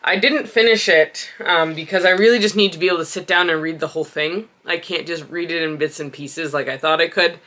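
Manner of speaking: ordinary delivery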